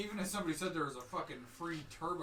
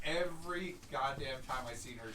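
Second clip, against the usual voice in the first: usual speaking voice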